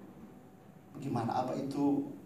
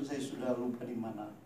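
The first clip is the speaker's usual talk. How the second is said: ordinary talk, nothing marked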